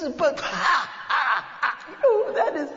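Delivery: high, loud, helpless laughter through the words; laughing father. Acted. Father in the cinema can't stop laughing, loud and high. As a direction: howl with laughter mid-sentence.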